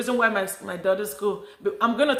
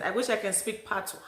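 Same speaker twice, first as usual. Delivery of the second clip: normal talking voice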